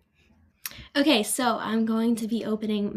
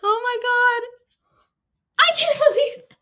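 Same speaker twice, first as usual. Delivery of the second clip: high, loud can't-believe-it; overjoyed disbelief; 13 semitones higher, 7 dB louder than usual. Real, not acted. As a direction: gasp it out with joy. Still reacting to acceptance.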